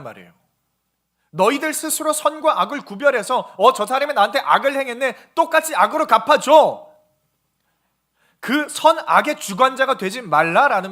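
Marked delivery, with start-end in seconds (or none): 1.3-10.9 loud, raised preaching voice; forceful preaching. Real, not acted. Preacher warning against judging good and evil, forceful. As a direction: drive the warning home loudly.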